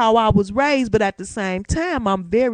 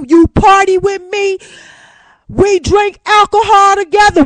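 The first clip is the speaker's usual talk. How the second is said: loud, higher, building sermon shout; fired-up preaching; 6 semitones higher, 7 dB louder than usual. Real, not acted. Preaching to listeners about the we word, shouting. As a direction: preach it at full force to the congregation.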